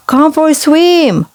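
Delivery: high, tiny call; Mister Small calls his friend into the sea; tiny cry. Acted. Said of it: "cried Mister Small".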